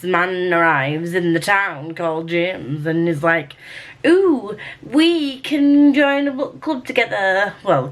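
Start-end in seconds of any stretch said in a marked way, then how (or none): 4.0-7.5 high, drawn-out mock-excited quote; playfully hammed-up book character; mock eager suggestion. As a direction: coo it higher, stretching the words. Voicing book character's eager proposal.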